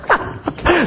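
quiet unvoiced chuckle; a breathy laugh escapes; soft breathy laugh. Real, not acted. Amused by his wife's spending, a quiet laugh.

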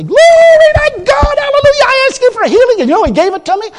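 very high, loud shout of praise; exuberant praise. Pretend. Preacher showing believers how to praise, ecstatic shout. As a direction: burst out rejoicing like it's already done. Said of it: "You gotta say".

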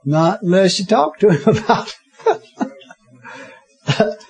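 slow, broken up by real laughter; laughing. Real, not acted. Preacher tells congregation God waits on their asking, laughing. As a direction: crack up midway through the line. Preacher amused by own remark.

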